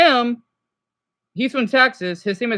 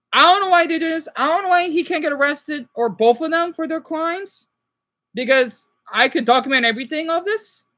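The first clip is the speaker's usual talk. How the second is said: raised, higher exasperated complaint; frustrated outburst; 6 semitones higher, 4 dB louder than usual. Real, not acted. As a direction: let the frustration burst out. Frustrated rant about pranksters.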